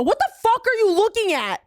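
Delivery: high-pitched indignant challenge; retold angry outburst. Friend repeating her challenge to the staring woman, angry. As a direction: demand it high, as in a bar spat. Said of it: "used to me being loud".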